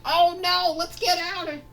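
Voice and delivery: very high, loud panicked cry; scared. Acted. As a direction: shriek it in mock panic to flee. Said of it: "they get scared".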